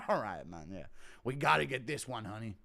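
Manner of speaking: lightly voiced husband talking to his wife; a hint of Chandler, pitched low; Chandler impression. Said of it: "Impression of Chandler hearing Teal's nickname".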